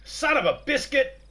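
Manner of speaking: loud, high shout of the name; exclaiming. Real, not acted. Streamer calling out a player name, loud high exclaim.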